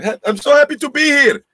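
hammed-up happy greeting; exaggerated excitement. Real, not acted. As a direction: voice jumps high and loud, gushing.

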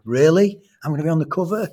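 put-on retelling a boy's thrilled high-pitched question; rising, amazed echo of a child; excited disbelief. Boy hears he'll be on the cover.

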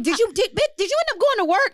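high-pitched mock disbelief; retold incredulous question. Friend checking on her after a night out, high mock amazement.

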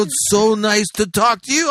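high, drawn-out patronizing niceness; exaggerated slow talking. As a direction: imitate talking down sweetly to someone. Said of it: "trying to talk to me slower".

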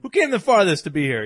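hyped host yelling a question to the audience; emcee call-out. Retold or imitated. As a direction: call it out across the crowd. Retold MC calling out crowd.

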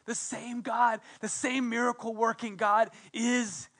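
ordinary delivery

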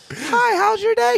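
put-on very high, slow sweet girl voice; girly falsetto.

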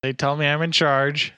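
high, loud put-on voice; high character voice. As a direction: announce yourself in a big silly voice. New character voice introduced.

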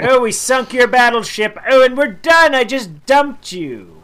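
loud taunting breakup; mocking breakup voice. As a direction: taunt like a smug ex. Voicing Germany breaking up.

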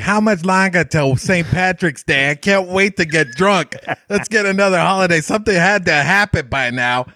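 high-pitched caricature of a Boston local; mock Boston Irish accent. Massachusetts locals eager for another holiday, mock Boston accent.

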